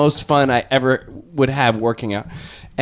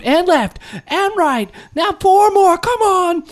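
fitness instructor calls; slower than usual. Retold or imitated. Guest imitates Richard counting the class through moves, high cheering calls. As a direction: shout out the moves like an eighties aerobics coach.

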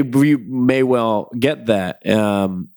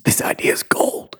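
whispering. Acted. Keeping the secret idea hushed.

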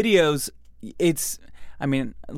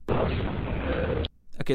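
whisper; 5 dB quieter than usual. Acted. Whispering before ghost clip.

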